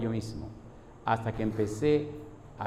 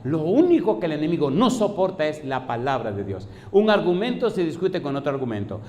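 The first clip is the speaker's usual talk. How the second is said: higher, driving sermon delivery; impassioned preacher building in volume; preaching at full force; 6 semitones higher, 4 dB louder than usual. Acted. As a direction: hammer each repeated phrase to the congregation.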